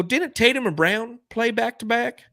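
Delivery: mock Reddit poster. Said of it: "They go".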